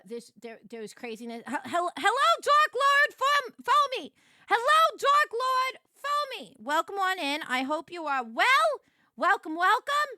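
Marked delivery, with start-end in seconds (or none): 1.5-4.1 squeaky high greeting voice, loud; high character voice. Acted. 4.5-6.6 squeaky high greeting voice, loud; high character voice. Acted. 6.6-10.1 loud, high and eager welcome; high welcoming voice. Acted. Character welcomes the Dark Lord.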